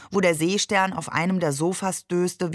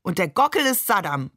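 ordinary delivery

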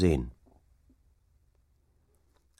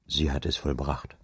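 quiet grief; 5 semitones lower, 5 dB quieter than usual. Acted. Father tells child mother died.

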